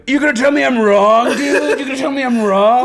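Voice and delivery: high, loud rising taunt; imitating a student confronting the teacher; mock challenge. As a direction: dare him to deny it, cocky.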